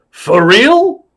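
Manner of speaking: loud, high rising disbelief; Al Pacino. Acted. Star asks clown one last time, loud high query. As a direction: shoot the question upward. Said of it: "He said".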